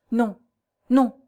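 mildly flustered, halting refusal; distraught stammer. A narrator's reading. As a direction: stammer it out, at a loss. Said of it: "balbutia la jeune fille avec une sorte d'égarement".

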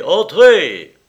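loud, high shout through a door; booming summons from inside the room; calling out. A narrator's reading. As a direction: call a visitor in at full voice. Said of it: "une voix, la voix de Lyra, répondit".

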